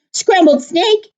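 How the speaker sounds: startled snake. Acted.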